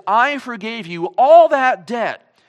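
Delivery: loud, slow, furious rebuke; enraged king. Acted. King rebukes the merciless slave, loud and furious. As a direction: bellow it like a wronged king. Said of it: "he becomes enraged and says".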